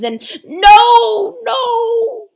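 high, loud howl of despair; howling beasts. Acted. Beasts howling back at the ape in dismay. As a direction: wail it out in protest. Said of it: "howled the beasts".

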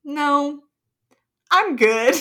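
put-on playfully firm kid turning down an invite; imitated little-kid refusal. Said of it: "first grade Chelsea was like".

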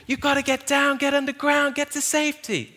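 high, loud panicked American yell; American accent. Retold or imitated. Scientists warning townspeople to get underground, in a loud American accent. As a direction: shout a warning in a big movie American accent. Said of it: "This is an American accent, by the way".

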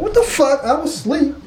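high, startled tree voice; a woken-up tree squeaking in outrage. Retold or imitated. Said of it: "the tree was like".

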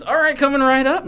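chirpy service voice; nurse voice. Acted. Friend plays a nurse taking the order, high and bright. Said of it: "Nurse is saying that".

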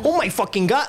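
high-pitched mock American gushing; hammed-up starstruck excitement; excited mimicry. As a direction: gush like a thrilled tourist, high-pitched. Mimicking Americans meeting someone Irish or Scottish.

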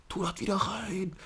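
high, drawn-out put-on order; slow, high mock scolding; scolding teacher. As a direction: mimic a squeaky teacher giving a command. Imitates teacher ordering snack away.

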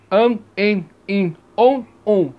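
am, em, in, on, um with nasal resonance; nasal vowels. Shown as a demonstration. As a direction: sound each of am, em, in, on, um through the nose. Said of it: "acompanhada de ressonância nasal".

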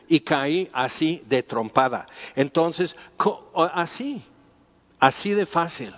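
ordinary delivery

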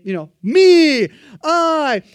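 "me" and "I" drawn out loud and high; stress on me. Retold or imitated. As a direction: lean hard on "me", then "I". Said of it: "that's just a little side note".

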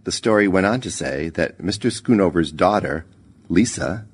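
ordinary delivery

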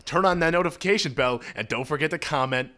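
loud peppy vlogger outro voice; YouTuber outro. Pretend. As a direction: do an over-the-top YouTuber ending the video. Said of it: "Like that?".

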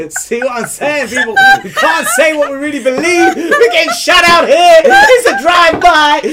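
high, loud laughing mock complaint; laughing mock outrage. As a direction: howl it out, half laughing.

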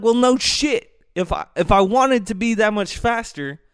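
high-pitched angry comeback; angry retort. Retold or imitated. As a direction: fire back, fed up and heated. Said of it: "I'm like".

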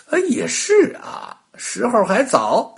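a hint of the old witch, high sigh; mild agreeing witch. A narrator's reading. Witch agrees with the rabbit, lightly voiced.

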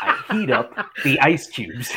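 punchline tipping over into giggles; Daniel Stern's goofy coach. Retold or imitated. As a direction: imitate the screwball coach while breaking into laughter. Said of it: "like, deadpan goofy".

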